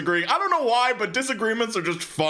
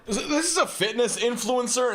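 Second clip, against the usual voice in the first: chuckling while introducing the next clip; amused giggly delivery; laughing; 6 semitones higher than usual. Real, not acted. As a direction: laugh it out, a bit higher. Still laughing after last clip.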